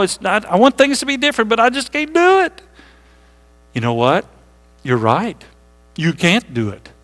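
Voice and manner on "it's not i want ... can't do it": whiny excuse. Retold or imitated. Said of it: "I have people say to me".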